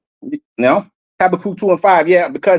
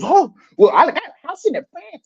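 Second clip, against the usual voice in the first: higher-pitched mocking imitation of a show-off; mock bragging rapper; 8 semitones higher than usual. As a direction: mimic a boastful rapper, pitched up.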